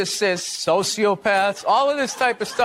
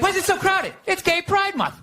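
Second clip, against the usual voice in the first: light, higher put-on voices trading lines; mock exchange; 8 semitones higher than usual. Replaying the Pride Month realization, playful higher voice. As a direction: act out the clueless question and answer. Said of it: "It's like".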